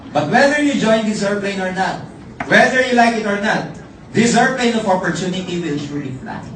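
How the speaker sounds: loud, rousing speech to the crowd; motivational close. Real, not acted. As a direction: fire up the audience.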